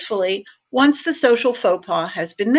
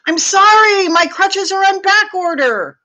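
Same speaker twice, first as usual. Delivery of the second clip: higher shout, loudest on sorry; shouted apology; 9 semitones higher, 4 dB louder than usual. Acted. Driver shouting an apology out the window, high and loud. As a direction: call it out the car window. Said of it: "roll down your window and shout".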